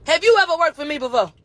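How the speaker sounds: brisk mock cross-examination; imitated defense lawyer. Defense lawyer questions the expert witness on the stand, imitated lawyer voice. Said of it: "he talking like this".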